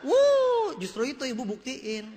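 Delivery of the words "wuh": high, loud drawn-out "wooh"; mock whoop. Seller reacting to ibu's demand for proof, mock whoop. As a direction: let out a long high whoop.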